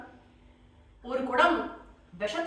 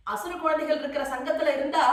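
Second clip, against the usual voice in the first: usual speaking voice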